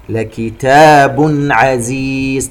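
slow chant, clear n in "لكتاب" before "عزيز"; throat izhar. Shown as a demonstration. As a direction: chant it, sounding the tanween of "لكتاب" cleanly. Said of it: "إظهار حلقي". (ترتيل بإظهار تنوين "لكتاب" قبل "عزيز")

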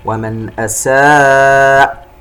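slow chant, long aa in "أساء" after clear n; connected madd. Shown as a demonstration. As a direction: hold "أساء" four counts. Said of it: "أساء فيها مد متصل". (ترتيل بإظهار "ومن" ومد "أساء")

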